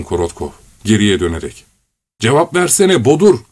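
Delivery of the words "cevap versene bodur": angry. A narrator's reading. Korotkov shouting at fat man.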